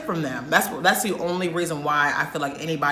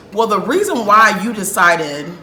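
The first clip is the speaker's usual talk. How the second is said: louder, heated scolding of the server; angry telling off; 3 semitones higher, 7 dB louder than usual. Retold or imitated. Hungry customer scolding the server, loud and heated. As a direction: tear into her for forgetting the order. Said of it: "I'm very upset".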